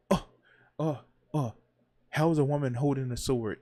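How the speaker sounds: loud, hammed-up indignation; mock outrage. Host imitates gamers upset by a female lead, mock outrage. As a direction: imitate a scandalized complainer, loudly. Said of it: "That's what I'm getting from some of these people".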